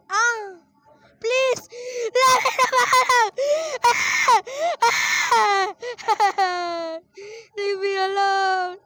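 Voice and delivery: high, loud, slow pleading cries; panicked screaming. Real, not acted. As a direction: beg to be left alone, half laughing in fright. Chased by monster in game.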